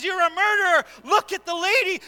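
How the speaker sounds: put-on guilty conscience yelling at a drunk driver; accusing inner voice. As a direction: hammer him with guilt. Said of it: "his voice inside of his head says".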